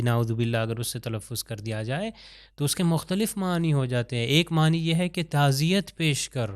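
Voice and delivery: normal talking voice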